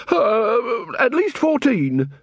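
high, complaining tradesman voice; a put-upon shopkeeper grumbling; Mister Flannel complaining to the watch; aggrieved shopkeeper. A narrator's reading. Flannel inflating the loss.

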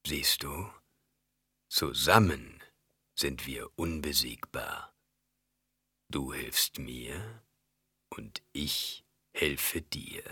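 very slow, low, soft coaxing baron; gentle voice. A narrator's reading. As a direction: coax softly, sinking into a whisper. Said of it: "mit sanfter Stimme". (mit sanfter, tiefer, langsamer Stimme)

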